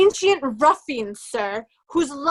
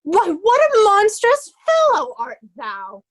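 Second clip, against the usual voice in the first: higher and louder, shocked outrage; outraged; 5 semitones higher, 5 dB louder than usual. Acted. Oswald shocked by Kent's abuse, outraged cry. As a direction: recoil at the insults out loud.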